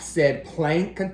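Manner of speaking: ordinary delivery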